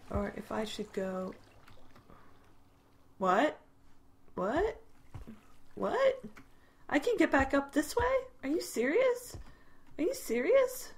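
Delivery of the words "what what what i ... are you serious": disbelief climbing from usual pitch to very high; questions rising higher in growing disbelief; incredulous. Real, not acted. Discovers an unexpected way up.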